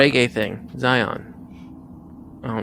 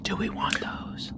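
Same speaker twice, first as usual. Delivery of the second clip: whisper; 7 semitones higher, 10 dB quieter, slower than usual. Real, not acted. Party conferring secretly at shop.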